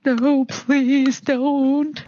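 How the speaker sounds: drawn-out pretend cry of panic; mock screaming plea. Acting out victims of Greg kicking doors, mock screaming plea. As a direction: beg in a slow, hammed-up wail. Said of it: "start, like, screaming".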